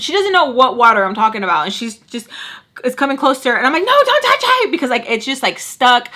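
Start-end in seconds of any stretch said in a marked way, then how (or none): 3.8-4.7 very high, loud mimicked panic; alarmed warning. Telling friend not to touch stuck needle, high loud alarm. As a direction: re-enact a frantic high-pitched warning.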